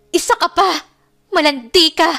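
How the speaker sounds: high, loud, fast furious accusations; angry tearful outburst. A narrator's reading. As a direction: rage through the tears. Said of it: "Galit niyang bigkas".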